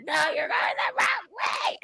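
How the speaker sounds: put-on high, slow, insistent drunk friend; mock drunk insistence.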